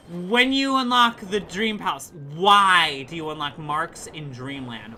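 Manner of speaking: loud rant. Real, not acted. Heated rant about game logic.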